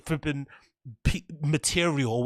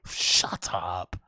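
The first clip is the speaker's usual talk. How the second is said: whispered; 9 semitones lower, 6 dB quieter than usual. Real, not acted. Muttered to himself.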